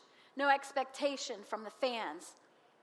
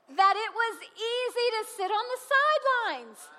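emphatic punchline; 7 semitones higher, 5 dB louder than usual. Acted. Preacher's punchline.

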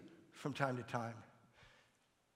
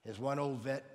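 normal talking voice

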